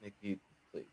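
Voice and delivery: very quiet, halting, unable to go on; too upset to continue; overcome. Real, not acted. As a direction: barely get the words out, long pauses. Overcome, hands off to co-host.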